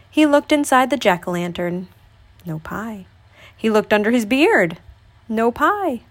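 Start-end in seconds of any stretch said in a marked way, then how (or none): 2.5-3.1 soft, dropped voice; hushed aside. A narrator's reading. Narrator's deadpan aside.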